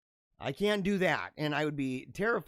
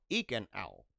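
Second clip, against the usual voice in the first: a hint of a lower, softer crackly game voice; old game voiceover; 9 semitones lower, 6 dB quieter than usual. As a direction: lightly mimic an early talking video game. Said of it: "It would go".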